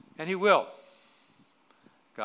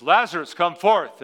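booming call to the dead; loud commanding cry; 6 semitones higher, 14 dB louder, slower than usual. Acted. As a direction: call him out of the tomb. Preacher acting Jesus calling out.

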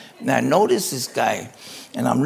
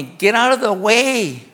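slow, higher mock order; drawn-out raised command, retold; imagined yelling; 5 semitones higher, 4 dB louder, slower than usual. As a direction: act out doctors yelling to clear the way. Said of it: "I thought it was the doctors yelling at me".

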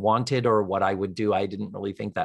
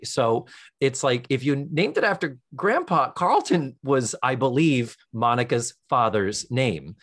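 normal talking voice

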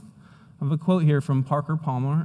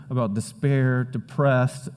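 ordinary talk, nothing marked

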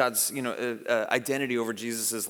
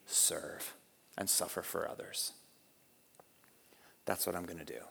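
low, softened declaration; quiet resolve; 6 semitones lower, 6 dB quieter than usual. Retold or imitated. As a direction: let the vow sink towards a hush. Jesus's solemn resolve voiced.

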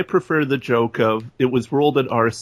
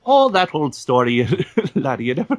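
a light stage-Irish lilt, laddie and all; hint of an Irish storyteller; Irish accent; 3 semitones higher than usual. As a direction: play a chatty old Irishman telling a tale. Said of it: "Your Irish accent is so much".

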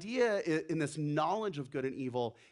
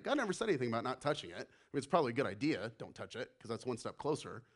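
fast aside; 6 semitones lower, faster than usual. Real, not acted. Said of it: "quick aside".

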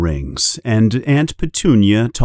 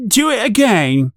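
much higher bratty command; bossy order; 12 semitones higher than usual. A narrator's reading. Dudley ordering his father, bossy. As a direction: order him around. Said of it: "Dudley orders his father".

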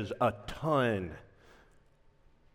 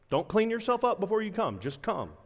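ordinary talk

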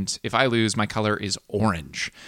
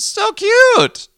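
much higher and louder squeal on cute; squealing delight; 10 dB louder than usual. Real, not acted. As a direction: gush over the puppy, squeaking. Gushing over a puppy gif.

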